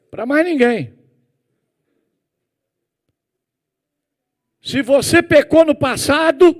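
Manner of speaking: loud, high, driving sermon voice; full-force preaching. Acted. Pastor exhorting the congregation on confession, preaching at full force. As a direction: hammer the point home to the congregation.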